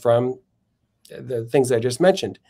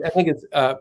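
ordinary delivery

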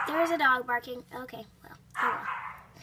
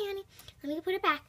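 normal talking voice

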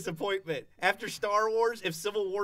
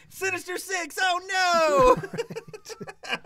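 higher, then louder laughing oh no; hammed-up hero panic; mock alarm; 7 semitones higher, 5 dB louder, much slower than usual. Friends mocking Spider-Man, high hammy alarm.

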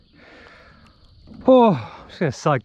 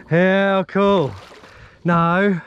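ordinary talk

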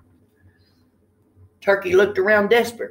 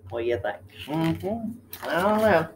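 plain reading voice

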